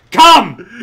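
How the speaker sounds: one explosive high bark; barked command. Acted. Imagined guy in smoking jacket, barked command. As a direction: bellow the order like a drill command.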